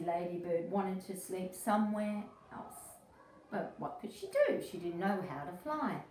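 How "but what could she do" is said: much higher, curious storyteller question; high wondering question. A narrator's reading. Reading to a child, a high curious question. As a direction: ask it high to invite a guess.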